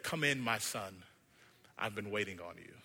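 low, soft fatherly welcome; gentle maker voice. Acted. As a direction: welcome a lost child home. Wicker maker welcomes boy.

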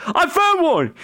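very high, excited yell; joyous scream. Acted. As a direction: scream it out like a thrilled henchman. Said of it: "screaming joyously".

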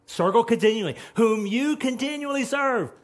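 king shouting into the den; yelled out. Retold or imitated. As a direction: call down into the pit with dread. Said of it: "he yelled out".

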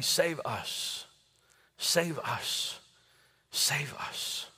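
soft chant fading into a whisper; whispered crowd plea dying away. Retold or imitated.

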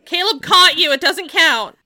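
loud, high protest; loud objection. Real, not acted. Teasing objection.

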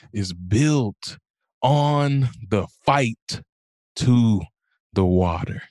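slow, weighty, word-by-word delivery; solemn lesson. Retold or imitated. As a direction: spread the words out with dramatic pauses. Retold specialist's solemn lesson.

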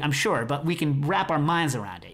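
ordinary talk

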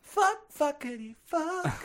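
high, slow, singsong swearing; playful swearing. Pretend. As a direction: sing out the curses for fun. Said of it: "Johnny swears".

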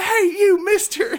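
high, loud mock holler; really loud greeting. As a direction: imitate an overloud hail from horseback. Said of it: "he's, like, really loud".

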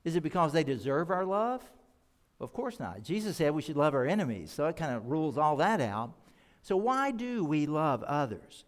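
usual speaking voice

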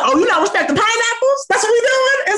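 very high sassy challenge; hammed-up offended partner; mock indignant. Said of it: "And then if you don't".

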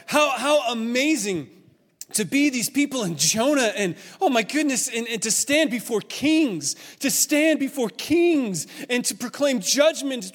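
high, loud, swelling wonder; awestruck excitement. Real, not acted. Preacher imagining standing before kings, awestruck. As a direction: marvel with rising fervour, stretching key words. Marvelling at the prophets' lives.

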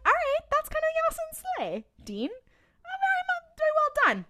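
high, campy mock applause; sassy praise. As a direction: gush at Dean like a fan. Vlogger sassily praising Dean.

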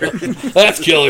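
slow laughing drawl; laughing, drawn out. Real, not acted. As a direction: stretch it through laughter.

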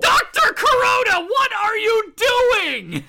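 loud, high exasperated shout. Real, not acted. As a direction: yell at the doctor in disbelief. Exasperated outburst at doctor.

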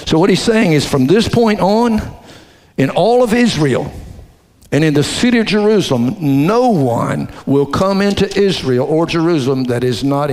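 loud, rising preacher's fervor; preaching at full force. Acted. Pastor exhorting church about Israel's future, loud preaching. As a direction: drive it home to the congregation.

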